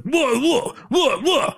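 crazy Cockney yelping, very high-pitched; Dynamite Kid. Retold or imitated. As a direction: imitate a wild Cockney wrestler in high squawks. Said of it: "he just has this crazy Cockney accent".

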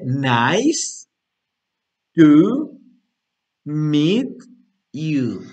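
"Nice to meet you" in separate, firm words; slow demo. Shown as a demonstration. Teacher reading the pronunciation guide, word by word. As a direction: leave a long gap after every word. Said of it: "un poquito más lento".

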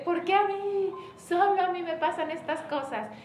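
a hammed-up lament; mock self-pity. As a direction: ham up feeling sorry for yourself. Mocking self-pitying women.

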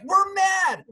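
high, loud hammed-up anger; mock angry shout. Speaker imitating stressed people, mock angry shout. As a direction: bark it like a grumpy stressed person. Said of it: "we're like".

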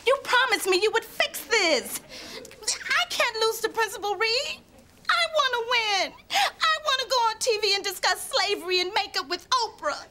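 high, whining and near tears; whiny pleading. Acted. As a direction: beg him in a tearful whine. Desperate pleading to Steve.

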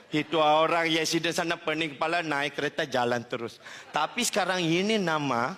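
ordinary talk, nothing marked

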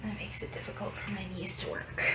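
quick late-night whisper. Real, not acted. Vlogger on the stairs at night, whispering. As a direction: hush it, the house is asleep.

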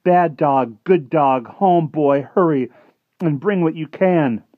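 high, loud pleading calls; urgent calling. Acted. Martin at the window calls the dog home, urgent and loud. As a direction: scold and beg the dog home.

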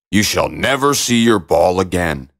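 low, ominous frog voice; low storybook frog making a threat; frog character. A narrator's reading. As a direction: warn as a low-pitched frog.

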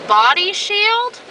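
high, slow puzzled question; baffled rise on shield. Real, not acted. Puzzled at the car wash sign.

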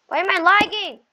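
high, loud whining complaint; angry yell. Real, not acted. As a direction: shout it in frustration. Gamer raging at lag.

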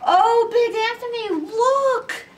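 high, loud startled cry; alarmed nun. A narrator's reading. A convent sister warns Big Anthony of the overflow, startled high cry. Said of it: "a sister from the convent said".

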